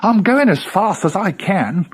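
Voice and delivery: very high, loud squeaky protest; squashed Rabbit. A narrator's reading. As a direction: squeal as you're being shoved.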